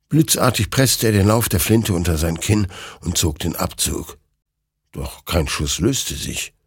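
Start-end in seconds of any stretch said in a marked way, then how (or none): none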